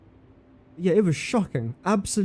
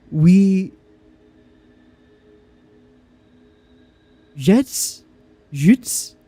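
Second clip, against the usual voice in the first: mock German; 3 semitones higher, 4 dB louder, much slower than usual. Shown as a demonstration. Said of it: "Jutes sounds French".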